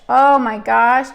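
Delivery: ordinary talk, nothing marked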